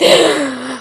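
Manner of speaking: sharp shocked intake of breath; theatrical gasp of shock; scandalized gasp. Acted. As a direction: gasp as if deeply offended. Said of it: "scandalized gasp".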